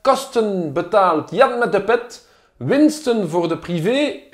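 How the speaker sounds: slow, careful Dutch read to French speakers; Dutch lesson read. Shown as a demonstration. Politician teaching his audience a Dutch headline, slow and careful. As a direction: spell out "Kosten betaalt Jan met de pet" slowly, word by word. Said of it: "on va faire un petit cours de néerlandais".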